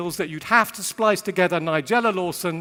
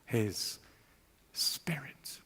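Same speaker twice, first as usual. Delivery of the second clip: whispered; 6 semitones lower, 15 dB quieter, much slower than usual. Real, not acted. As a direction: drop to a hushed whisper on the final word. Sermon climax on the Spirit.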